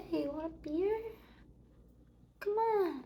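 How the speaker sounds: ordinary talk, nothing marked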